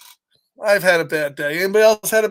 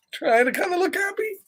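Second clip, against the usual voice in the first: forced cheer; 10 semitones higher, 5 dB quieter, faster than usual. Acted. Showing a forced smile.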